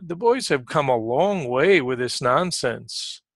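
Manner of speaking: usual speaking voice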